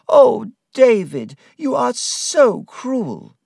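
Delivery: high, loud, drawn-out wail; hurt, high-pitched complaint; on the verge of crying. Acted. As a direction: wail it reproachfully, slowly. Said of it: "She began to cry".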